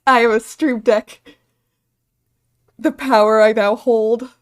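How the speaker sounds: booming mock-villain boast; mock grand boast. Streamer hamming up owning a stream deck, booming. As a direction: loudly proclaim your new power.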